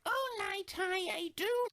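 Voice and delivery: high, squeaky haunted toy; creepy toy voice. Retold or imitated. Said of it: "blankly staring at me like".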